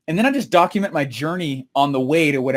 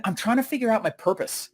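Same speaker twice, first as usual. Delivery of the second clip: softer, higher earnest reply; earnest answer; 5 semitones higher, 5 dB quieter than usual. Retold or imitated. Speaker answers friends asking how it's going, earnest and soft. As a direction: confide it quietly, retelling yourself.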